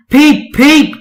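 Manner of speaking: high loud panicked warning; warning whistle. Acted. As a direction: shout a warning to clear the way. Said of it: "he whistled".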